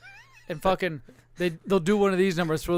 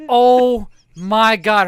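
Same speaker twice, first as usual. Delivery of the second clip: slow disgusted gasp; 3 semitones higher, 8 dB louder, much slower than usual. Retold or imitated. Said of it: "She's like".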